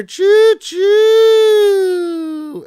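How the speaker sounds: a high, loud choo choo like a steam whistle; train whistle call. Pretend. Streamer greets the engineer viewer with a train whistle. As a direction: blast it out like a toy train.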